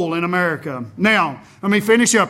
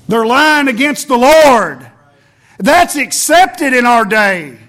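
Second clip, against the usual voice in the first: louder and slower, each word punched; pulpit thunder; 3 semitones higher, 6 dB louder, slower than usual. Real, not acted. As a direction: thunder it out against the lie. Preacher condemns from the pulpit.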